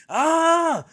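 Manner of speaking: high, shouted mock of an overexcited caller; excited caller. Mocking Sarge's excited phone call about the trial, high shouting. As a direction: ham up the breathless caller, high and yelling. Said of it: "that man was so excited when he called me".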